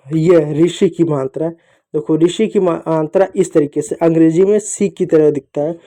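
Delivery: usual speaking voice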